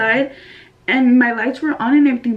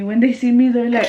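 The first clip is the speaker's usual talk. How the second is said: normal talking voice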